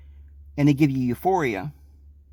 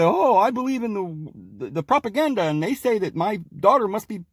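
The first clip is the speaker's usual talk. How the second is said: mock worried parent; 5 semitones higher than usual. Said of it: "your parents get, say".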